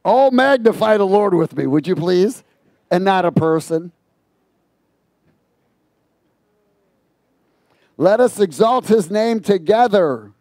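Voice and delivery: loud exhortation to the crowd; full-force preaching. Real, not acted. Preacher urging the congregation, loud and fervent. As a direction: call the church to praise.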